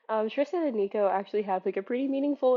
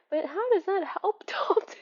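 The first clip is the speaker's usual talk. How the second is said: laughing whisper; 8 semitones higher than usual. Real, not acted. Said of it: "sorry, my voice".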